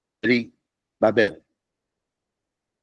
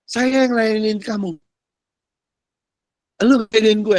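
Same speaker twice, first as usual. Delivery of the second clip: God speaking; 7 semitones higher than usual. Retold or imitated. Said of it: "Artinya gimana?".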